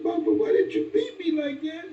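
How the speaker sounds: high, bewildered question; hurt child asking. Retold or imitated. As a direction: ask like a wounded little boy. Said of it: "I said".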